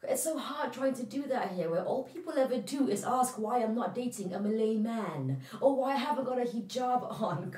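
high, loud frustrated rant; exasperated venting. Acted. Daughter vents to mother about others' expectations, exasperated. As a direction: let the pent-up frustration pour out.

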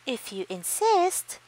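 high, slow and sweet; sweetly. A narrator's reading. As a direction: answer sweetly, unafraid. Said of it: "answered the friendly ladybug sweetly".

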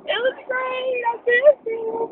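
high, slow tearful voice cracking on the words; reliving the moment she broke down crying; breaking into tears. Retold or imitated. Answering coworkers about his Christmas, voice breaking into sobs. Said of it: "I mean, I just bawled".

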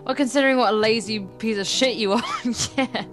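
usual speaking voice

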